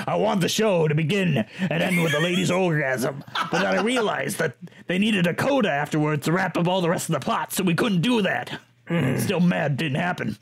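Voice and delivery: hint of a very American man's accent; mock all-American voice; lightly put-on American accent for a quote; Sondheim. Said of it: "the most American man in the world".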